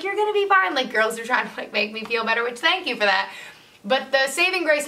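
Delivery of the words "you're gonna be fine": bright reassurance. Retold or imitated. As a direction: cheer her on brightly. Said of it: "And Ashley's like".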